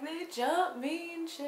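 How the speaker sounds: high, slow whining claim; high drawn-out whine; mock whiny victim. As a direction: whine it like a wronged drunk. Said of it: "she tells Shannon, like".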